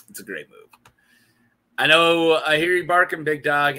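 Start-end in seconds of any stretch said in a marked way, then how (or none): 0.1-0.9 muttered aside. Real, not acted. Muttered defense of favourite move.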